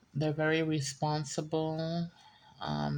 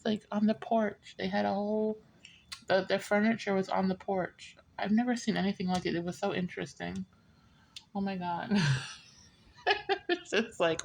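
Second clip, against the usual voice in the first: ordinary talk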